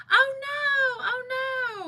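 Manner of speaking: high, crying "oh no"; mock wail of dismay. Real, not acted. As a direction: wail it out in playful grief. Dismayed reaction to a commenter.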